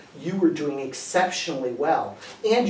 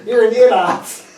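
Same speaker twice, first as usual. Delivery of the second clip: laughing teasing insult; 5 semitones higher, 7 dB louder than usual. Real, not acted. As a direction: laugh through the jab.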